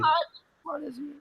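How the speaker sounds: echoing the mock voice. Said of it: "He says, like".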